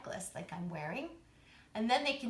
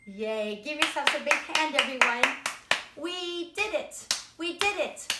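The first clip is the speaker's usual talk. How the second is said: loud, high cheer for the class; 4 semitones higher, 8 dB louder than usual. Acted. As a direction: celebrate with the children.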